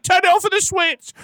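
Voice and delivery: wife's voice. Retold or imitated. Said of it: "his wife was like".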